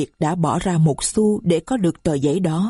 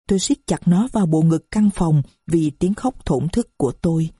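plain reading voice

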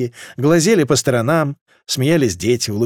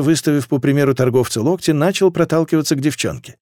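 usual speaking voice

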